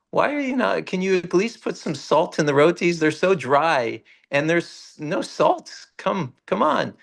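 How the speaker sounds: put-on high, pleading little boy; whining child deity. Boy deity begging the saint for salt, whiny and high. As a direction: nag for salt like a petulant child. Deity complains about saltless rotis.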